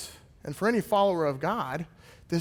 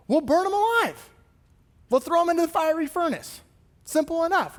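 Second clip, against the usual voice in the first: much higher, mocking voice of the cruel king; mock tyrant king; 11 semitones higher, 3 dB louder than usual. Preacher voicing the king's decree to his people, mock tyrant. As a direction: toss off the death sentence like a breezy tyrant.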